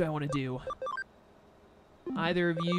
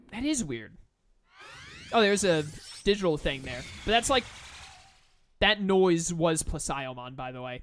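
usual speaking voice

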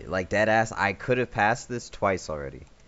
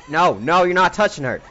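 shouting; 6 semitones higher, 7 dB louder than usual. Real, not acted. Defending character loudly.

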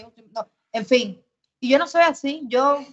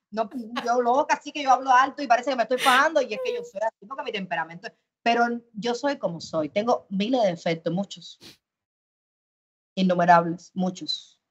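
usual speaking voice

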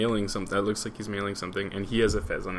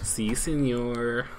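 slow, drawn-out mock Spanish accent; much slower than usual. Finishing her joke with a slow mock Spanish accent. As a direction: stretch it out in a hammy Spanish accent. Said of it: "That's my best Spanish".

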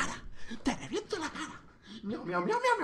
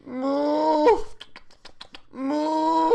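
high, squeaky cow moo; mock mooing; 12 semitones higher than usual. Streamer mooing at a stranger on video chat, high mock moo. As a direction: moo like a silly cartoon cow.

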